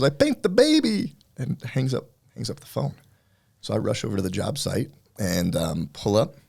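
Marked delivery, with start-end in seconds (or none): 0.0-1.1 much higher, louder frantic retold scream; panicked screaming. Painter screaming to his boss over the phone, panicked high yell. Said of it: "He goes".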